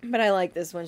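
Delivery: ordinary talk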